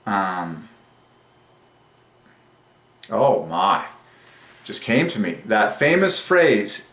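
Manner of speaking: ordinary talk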